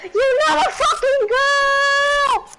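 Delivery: high slow laughing yell; laughing shout. Real, not acted.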